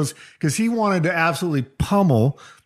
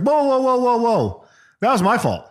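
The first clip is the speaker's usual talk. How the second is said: retold whoa; 7 semitones higher than usual. Rodgers stepping in for Marshawn.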